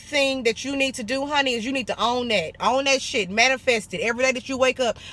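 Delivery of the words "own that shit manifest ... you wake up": usual speaking voice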